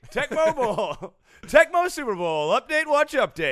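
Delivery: loud, high segment jingle; excited jingle. Pretend. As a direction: hype it like a game-show bumper.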